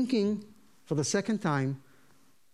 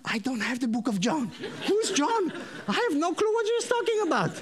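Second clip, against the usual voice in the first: high-pitched, exasperated confusion; comic bewilderment; 8 semitones higher than usual. Retold or imitated. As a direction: play utterly clueless for laughs. Acted bewilderment at Bible study.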